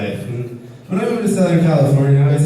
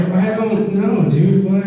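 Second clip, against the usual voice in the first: confused shrug; 5 semitones higher, much slower than usual. Retold or imitated. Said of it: "And I'd be like".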